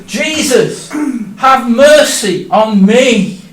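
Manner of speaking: loud, slow, pleading shout. Acted. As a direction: drag out the plea at full voice. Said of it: "I cried even louder".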